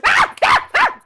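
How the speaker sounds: loud, high exasperated yells; frustrated screams. Real, not acted. Frustrated at the game.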